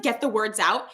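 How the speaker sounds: ordinary talk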